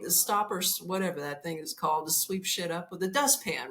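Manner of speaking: ordinary talk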